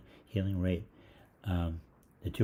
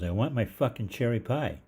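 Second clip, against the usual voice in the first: put-on kid yelling; 5 semitones higher, 5 dB louder than usual. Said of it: "So the voice goes".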